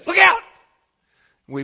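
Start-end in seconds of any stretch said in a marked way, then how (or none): 0.0-0.4 sudden high, loud warning cry; imitating wife's yell. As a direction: play the startled wife in the passenger seat. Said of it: "Because she'll say".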